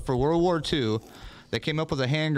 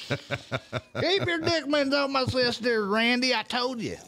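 much higher, slow, laughing Southern warning; Southern brother; 11 semitones higher, slower than usual. Retold or imitated. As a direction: warn him off your sister, hillbilly style. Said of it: "whoever said".

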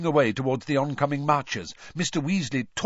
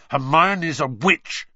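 slow, louder snarl; Harry defending Hermione against Malfoy, slow and louder; 4 semitones higher, 6 dB louder, slower than usual. A narrator's reading. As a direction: bite each word out at him. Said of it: "Harry snarled".

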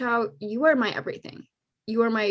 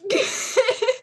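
high burst of laughter; 12 semitones higher than usual. Real, not acted. Host laughing at the Cody joke, high laugh.

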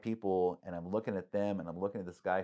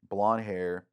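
ordinary talk, nothing marked

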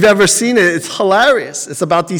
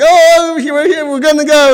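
excited rush; 10 semitones higher, 3 dB louder than usual. Retold or imitated. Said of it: "they're like".

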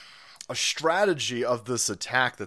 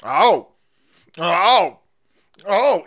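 loud, drawn out mocking oh; loud mock gasps; 4 semitones higher, 10 dB louder, much slower than usual. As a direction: call out oh in mock shock. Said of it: "and I was like".

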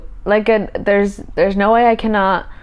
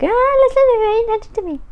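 high whiny mock pleading; whiny clingy voice. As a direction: whine it like a clingy partner. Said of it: "I wasn't being like".

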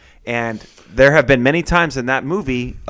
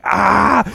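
loud charging yell; battle cry yell; 10 semitones higher, 6 dB louder than usual. Retold or imitated. Charging Thanos with axe.

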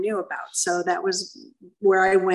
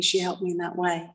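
ordinary talk, nothing marked

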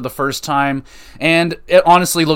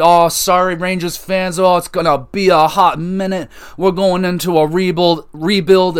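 mock team announcement; 5 semitones higher than usual. Rangers brass telling fans about the rebuild, hammed-up apology. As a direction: play a front office breezily apologising to fans.

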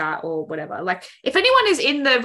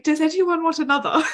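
higher-pitched put-on British accent; 8 semitones higher than usual. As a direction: ask it in a playful British accent. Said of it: "like, a British accent".

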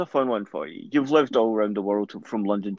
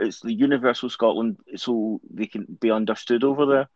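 normal talking voice

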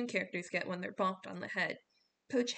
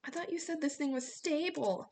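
shouted over wind; 7 semitones higher than usual. A narrator's reading. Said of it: "Jason shouted over the wind".

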